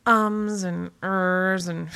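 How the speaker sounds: slow, drawn-out hesitation; hesitant ums. Shown as a demonstration. Blogger demonstrating pacing devices, drawn-out hesitation. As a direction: stretch it out like someone stalling. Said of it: "built into our text to help pace the reader".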